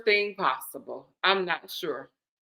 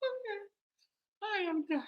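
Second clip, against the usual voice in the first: high, faint, very soft; tiny laughed-out voice; spent from laughing; 7 semitones higher, 12 dB quieter than usual. Real, not acted. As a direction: give up weakly after laughing hard. Worn out from laughing, gives up.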